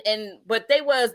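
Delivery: ordinary delivery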